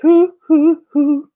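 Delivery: very high, loud hoo-hoo owl; owl hoot. Acted. Joke about a Travolta owl, high hooting owl voice. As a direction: sing out the hoots like a disco owl. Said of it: "He was like".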